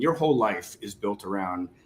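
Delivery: usual speaking voice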